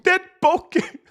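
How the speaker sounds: "bokeh" squeaked high and loud like "boh-kee"; mocking his bokeh. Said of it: "that's the way he said bokeh".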